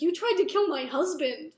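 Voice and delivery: loud retold indignation; acted accusation. She accuses the king over her husband, loud indignant retort. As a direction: fling the charge at them, acted out.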